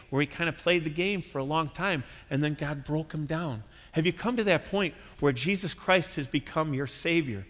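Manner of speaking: ordinary talk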